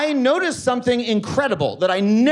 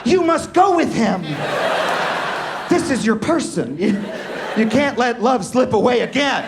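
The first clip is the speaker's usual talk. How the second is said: mock pleading; 3 semitones higher than usual. Comic urging the window-seat man to run after his friend, mock dramatic cry. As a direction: urge him to chase love, half-joking. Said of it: "And I was like".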